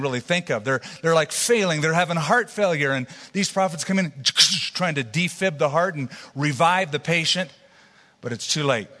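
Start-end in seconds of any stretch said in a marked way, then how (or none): 4.2-4.7 imitated electric paddle shock; defibrillator zap noise. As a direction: mimic a quick burst of static from a defibrillator. Imitates defibrillator while describing revival.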